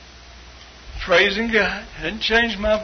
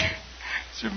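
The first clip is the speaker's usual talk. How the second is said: normal talking voice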